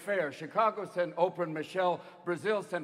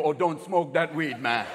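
slow, lower Jamaican voice; laid-back mock Jamaican; Jamaican accent; 6 semitones lower, 3 dB louder, slower than usual. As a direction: warn slowly in a Jamaican accent. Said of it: "even Jamaicans go".

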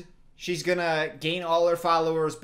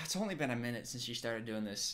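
ordinary talk, nothing marked

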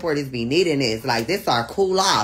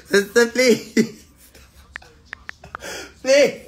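laughing plea; 5 semitones higher, slower than usual. Real, not acted. Begging chat to stop, laughing.

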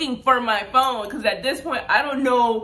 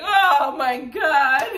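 drawn-out, louder oh my god in alarm; slow stunned oh my god; 4 semitones higher, 7 dB louder, much slower than usual. Retold or imitated. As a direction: let the shock stretch every word. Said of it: "I'm like".

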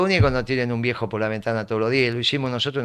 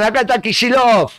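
raised, high call across a crowd; mock yell, pitched well up and louder; retold shout; 7 semitones higher, 5 dB louder than usual. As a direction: call it out excitedly, imitating someone shouting. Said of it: "me gritan".

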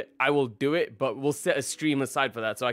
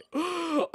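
sharp gasp; 12 semitones higher, 3 dB quieter than usual. Real, not acted. Sees the event coming.

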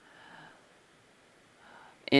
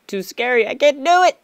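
scared squeal; 8 semitones higher, 5 dB louder than usual. Retold or imitated. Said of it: "I was like, Oh my god".

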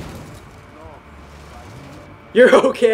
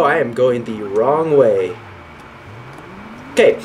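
usual speaking voice

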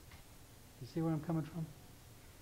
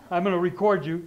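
loud, raised-pitch mock threat; playful raised-voice imitation of a wife; imitating wife's threat; 4 semitones higher, 9 dB louder than usual. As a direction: quote the wife loudly, amused. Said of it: "my wife will say".

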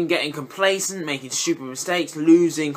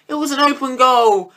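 exasperated complaint; 6 semitones higher, 5 dB louder than usual. Real, not acted. Repeats the complaint.

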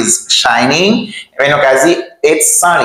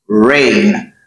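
normal talking voice

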